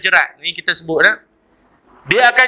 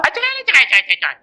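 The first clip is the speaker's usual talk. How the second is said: sudden high shrill-free squeal of cerai then dropping; mock wife crying out for divorce; hammed-up wife yelling cerai; wife demanding divorce; 5 semitones higher, much faster than usual. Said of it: "Dan isteri pula".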